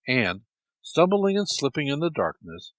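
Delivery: ordinary delivery, nothing marked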